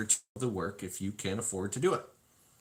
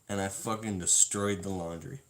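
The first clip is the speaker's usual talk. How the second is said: ordinary talk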